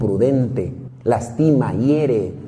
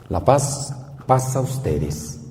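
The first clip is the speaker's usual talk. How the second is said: gentle blessing; 3 dB quieter, slower than usual. Acted. Jesus blessing frightened disciples.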